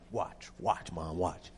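soft, low, hushed urging. Retold or imitated. Son to his mother in church, hushed urging. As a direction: quietly nudge mom to watch.

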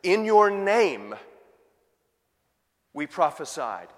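"your" hit hard in each "In your name", slowly; slow, repeated "In your name" with weight on "your"; stress on your name. Shown as a demonstration. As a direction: lean on "In your name" every time. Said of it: "the Greek is emphatic there".

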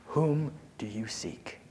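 whispered slowly, unvoiced. Shown as a demonstration. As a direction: breathe the question, slow and hushed.

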